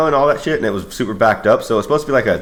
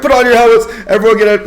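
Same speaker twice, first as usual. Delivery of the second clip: raised, high-pitched scrambling command; urgent shouted order; 12 semitones higher, 5 dB louder than usual. Retold or imitated. Everyone ordering each other into gear, urgent shouting. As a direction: call out orders in a rush of alarm.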